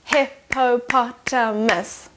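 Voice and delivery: hip-po-pot-a-mus, each syllable split slowly; syllables clapped out. Shown as a demonstration. Teacher clapping Hippopotamus out for children, slow and split. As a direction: break Hippopotamus into its beats. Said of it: "clap out the syllables".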